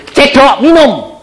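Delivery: high, slow, loud command; hammed-up slow order; mock order. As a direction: bark it out slowly like a boss. Voicing the order.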